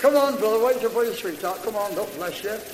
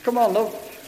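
ordinary talk, nothing marked

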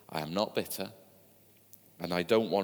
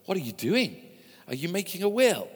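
higher, louder alarmed question; startled doctor; 9 semitones higher, 5 dB louder than usual. Acted. Doctor startled by his rabid patient, loud alarmed question. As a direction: blurt it out, alarmed.

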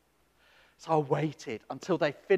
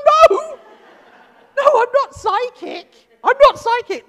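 high, loud, half-laughing protest; laughing loud denial; 14 dB louder than usual. Retold or imitated. Minister told to go to the psychic fair, loud laughing no. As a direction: laugh it off and refuse loudly.

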